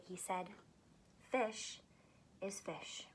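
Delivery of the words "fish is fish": gentle. Acted. Fish's quiet closing realisation.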